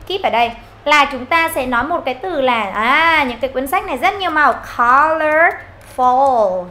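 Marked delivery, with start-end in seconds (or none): 4.8-6.6 "Colorful" very slowly, ending on a dark L; final L. Shown as a demonstration. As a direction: draw out "Colorful" to show the last L. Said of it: "Âm L cuối này".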